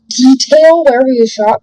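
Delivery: ordinary delivery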